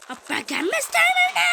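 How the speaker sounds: high, loud shouted character line; shouting. Acted. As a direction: yell it out in a squeaky voice. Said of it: "dayı ne bağırıyorsun ya". (ne bağırıyorsun dedikleri yüksek, tiz bağırış)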